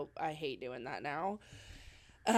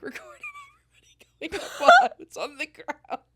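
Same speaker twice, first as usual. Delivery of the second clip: laughing; 13 dB louder than usual. Real, not acted. Laughing while telling embarrassing story.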